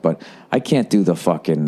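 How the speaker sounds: usual speaking voice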